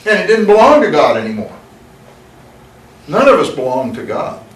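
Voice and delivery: preacher's climb to he stole it; rising preaching. Acted. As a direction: build the story up to the fall. Preaching builds to climax.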